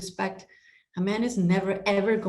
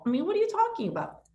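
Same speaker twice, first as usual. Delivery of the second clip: incredulous; 13 semitones higher, faster than usual. Retold or imitated. Her incredulous reply retold.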